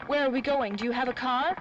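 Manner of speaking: urgent and alarmed; rushed, anxious questions. Acted. Fleeing police, urgent questions.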